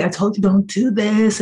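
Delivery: put-on high, slow whiny teen; mock the fake-embarrassed girl; imitating embarrassed daughter. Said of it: "she would always come downstairs like".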